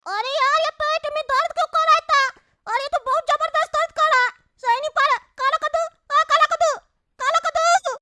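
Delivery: put-on high-pitched whining child voice; squeaky kid complaining of a bellyache; high, plaintive cartoon child; little boy in pain. Child character with stomach ache.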